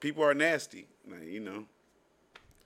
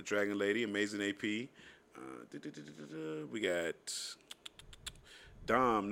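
lower, softer rapid roll call; shout-out list; 6 semitones lower, 5 dB quieter than usual. Acted. Podcast host reading listener shout-outs, low steady roll call. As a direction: rattle off the names like a host.

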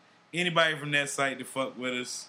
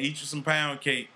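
usual speaking voice

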